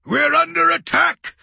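very high, loud cry of alarm; alarmed shout. A narrator's reading. Crewman reporting an attack to commander, alarmed shout. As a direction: shout the alarm across the deck.

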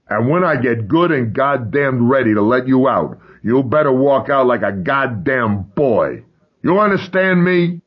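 loud furious threat; shouting. Acted. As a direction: bellow the threat through the door. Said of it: "shouted over Thomas's screaming".

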